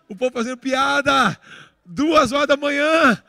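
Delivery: high, loud, indignant-sounding amazement; loud incredulous exclamation. Real, not acted. Preacher telling the congregation about jokes at that hour, loud disbelief. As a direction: belt it out, stretching the last words.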